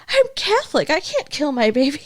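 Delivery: high-pitched imitated outcry; hammed-up indignant plea, starting very high; mock protest. Retold quarrel, pregnant woman protests.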